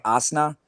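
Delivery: Asna for Arsenal, R and L dropped; imitated African accent. Imitating online commenters' name for the club, African-accented Asna. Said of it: "what I would call an African accent".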